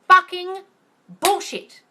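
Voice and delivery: furious emphatic swearing; angry outburst. Real, not acted. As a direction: spit out the words in anger. Angry rant, self named angry line 90.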